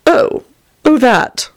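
high, deflated realisation; flustered, faltering confession; sighing. A narrator's reading. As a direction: admit it haltingly with a sigh. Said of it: "Tony sighed".

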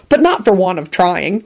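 usual speaking voice

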